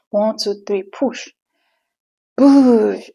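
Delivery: a high, loud command to push; urging push. Retold or imitated. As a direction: bark it like the delivery team. Doctors counting down, urging push.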